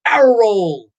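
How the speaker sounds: excited shout of coaster moves; yelling ride moves. Retold or imitated. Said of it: "I'd be yelling".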